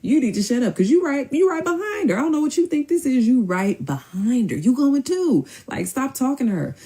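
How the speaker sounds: high, emphatic telling-off; retold scolding. Host telling the man he is going too, scolding.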